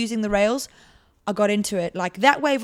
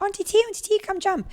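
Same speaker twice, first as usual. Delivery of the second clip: put-on high-pitched little-kid voice; high childish pleading; nieces calling; 11 semitones higher than usual. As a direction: mimic small nieces begging auntie to play. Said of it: "They're like".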